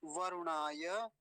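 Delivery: वरुणाय दित्या slowly, long vowels held; measured, syllable-by-syllable pronunciation; slow word model. Shown as a demonstration. Teacher reviews the big word for students, slowly and measured. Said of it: "फिर से देख लीजिए".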